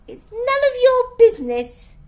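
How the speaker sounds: high, loud, cross retort; heated, high-pitched telling-off; indignant rebuff. A narrator's reading. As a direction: snap it back to shut her out. Girl snatches plate away, quarrels.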